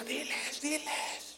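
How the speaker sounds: hissed, soft, high diles; urgent whisper. Retold or imitated. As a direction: whisper urgently from the front row. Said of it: "Y yo le decía".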